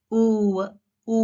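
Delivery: "ఊ" as a long, rounded oo; W lip-rounding demo. Shown as a demonstration. Teacher showing how the mouth starts a W, drawn-out rounded "ఊ". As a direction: draw out "ఊ" with lips pushed forward. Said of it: "like this".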